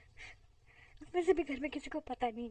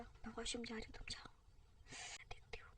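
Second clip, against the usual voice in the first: whisper; 3 semitones lower, 12 dB quieter than usual. Acted.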